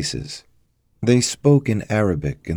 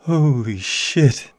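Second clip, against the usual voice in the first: slow, higher-pitched muttered curse; stunned mutter; 8 semitones higher, much slower than usual. A narrator's reading. Damien seeing his last moment, muttering in stunned dread. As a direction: mutter it slowly, facing the end. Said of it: "Damien muttered".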